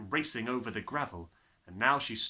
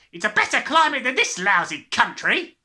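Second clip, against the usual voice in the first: loud, much higher bellowing father; gruff father; 11 semitones higher, 16 dB louder than usual. Acted. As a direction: bark it out like a hurried father.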